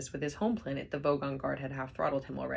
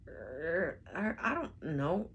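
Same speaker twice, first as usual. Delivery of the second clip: slow, thick hesitation; hesitant guard; much slower than usual. A narrator's reading. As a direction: stumble out slowly.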